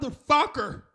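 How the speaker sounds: high, loud exasperated outburst; mock outraged yell.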